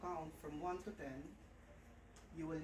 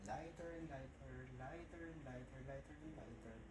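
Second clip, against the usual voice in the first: softer and softer each time; fading hypnotic repetition; 3 semitones lower, 7 dB quieter than usual. Acted. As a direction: let it fade into a murmur.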